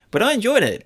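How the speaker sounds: ordinary talk, nothing marked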